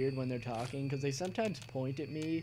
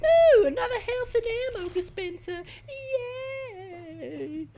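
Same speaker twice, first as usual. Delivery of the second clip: much higher, louder squeaky little character; squeaky cartoon voice; 5 dB louder than usual. Gamer squealing at ostriches in a squeaky voice. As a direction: squeal it like an excited cartoon critter.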